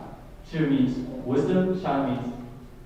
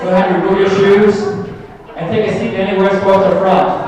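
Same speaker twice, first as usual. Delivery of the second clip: announcer; 6 semitones higher, 11 dB louder than usual. Real, not acted. Ushering crowd by loudspeaker.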